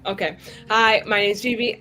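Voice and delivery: usual speaking voice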